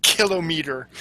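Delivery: "kilometer" as KILL-oh-meter; measured "kilometer", weight on KILL; kilometer stressed on KILL. Shown as a demonstration. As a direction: say "kilometer" with a full OH and MEE. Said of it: "Canadian pronunciation".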